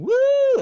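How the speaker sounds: a high, loud mock woo. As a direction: whoop like a party girl. Imitating the flashing woman.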